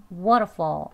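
"Waterfall" short, with a weak final L; conversational pronunciation. Shown as a demonstration. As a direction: reduce "Waterfall" to "wa-ruh-faw". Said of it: "이번엔 대화 발음입니다".

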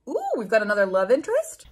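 ordinary talk, nothing marked

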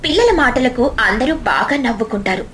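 ordinary delivery, nothing marked

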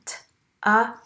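T, a, k sounded out one by one; segmented sounds. Shown as a demonstration. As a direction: sound it out letter by letter. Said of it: "The T goes at the beginning".

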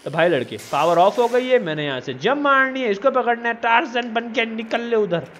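normal talking voice

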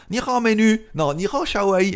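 mock Chinese accent, deliberately clumsy; bad Chinese accent. Waking the Chinese voice assistant in a clumsy Chinese accent. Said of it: "Avec mon accent de psychopathe".

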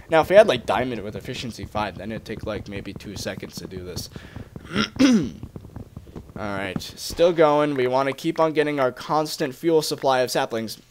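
ordinary talk, nothing marked

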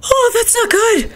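scared. Real, not acted. After jump scare.

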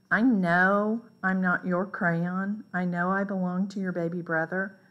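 ordinary delivery, nothing marked

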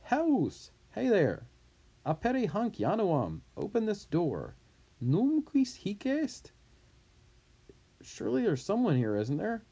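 loud calling through a door. Acted. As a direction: shout to be let in. Messenger shouting at the door.

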